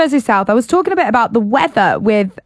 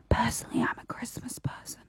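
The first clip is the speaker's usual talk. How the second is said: whisper; 3 semitones lower, 19 dB quieter than usual. Real, not acted. Confiding aside in a whisper.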